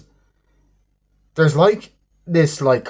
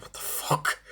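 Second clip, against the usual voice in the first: breathy whispered what the fuck; hushed aside of disbelief; 11 dB quieter than usual. Retold or imitated. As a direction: whisper it, stunned. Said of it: "I'm just like".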